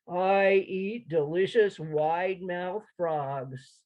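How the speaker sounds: slow, menacing drawl; deep alligator drawl. Acted. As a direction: savour each word like a hungry alligator. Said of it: "said in a deep drawl".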